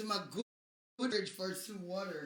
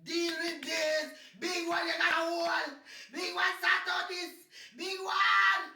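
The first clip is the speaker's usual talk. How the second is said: calling out; 7 semitones higher than usual. Acted. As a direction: shout the name across the room, pitched up. Calling out to Big One.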